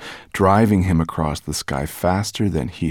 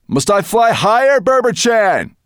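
high, loud call over strong wind; 7 dB louder than usual. A narrator's reading. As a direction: shout the question over the wind. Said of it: "called Firedrake as the wind blew".

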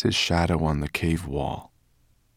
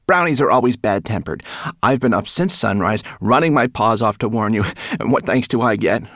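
higher, loud and indignant; angry rat; 4 semitones higher, 6 dB louder than usual. A narrator's reading. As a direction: rant at the brownie. Rat complains angrily.

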